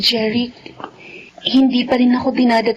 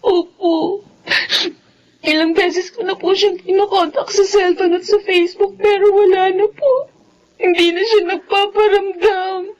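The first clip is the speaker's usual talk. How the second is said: higher, crying through the words; tearful admission; 4 semitones higher than usual. Acted. Daughter telling her mother he vanished, crying. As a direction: choke up telling mom the truth.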